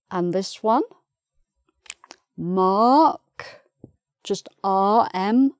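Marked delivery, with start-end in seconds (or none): 2.4-3.2 "mark" as a long rising "maahk", R silent; louder teaching "mark" without the R. Shown as a demonstration. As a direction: say "mark" the British way, stretched open vowel. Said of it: "no R".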